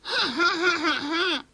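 high mocking cackle; witch cackle. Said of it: "ricana la sorcière". (ricanement de sorcière aigu)

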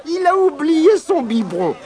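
sing-song the taunt, stretching each word; mocking monkey. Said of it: "criait le singe".

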